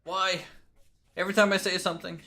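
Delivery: fast, high, loud exclamation; panicked outburst. Real, not acted. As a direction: blurt it out, rattled. Startled by own jump scare.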